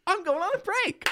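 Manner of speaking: very high, sing-song announcement; mock chirpy barista. Barista announcing his break, very high and chirpy. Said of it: "he's like".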